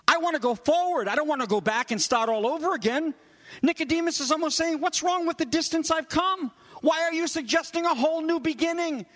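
high-pitched, protesting bewildered teacher; Nicodemus. Acted. Bewildered teacher objects to Jesus about starting over, protesting. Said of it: "Nicodemus seems to be saying".

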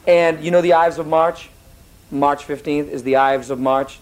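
ordinary talk, nothing marked

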